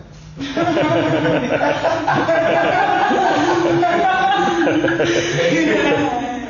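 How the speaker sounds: gargalhada longa e aguda, bem alta; risada alta. Real, not acted. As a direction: solta uma gargalhada de verdade. Risada alta após citar o filho. (gargalhada alta e aguda)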